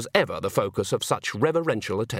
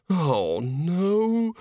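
long-drawn moan rising in pitch; moaned dismay; 5 semitones higher than usual. Acted. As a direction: wail it in theatrical despair. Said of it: "moaned Bucket".

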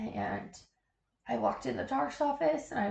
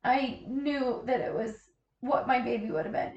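ordinary delivery

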